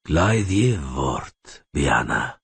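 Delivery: slow, calm command; unhurried, falling low at the end. A narrator's reading. Fair-haired elf addresses Rayla, in a calm voice. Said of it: "in a calm voice".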